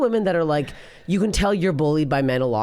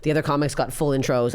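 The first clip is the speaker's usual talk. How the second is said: normal talking voice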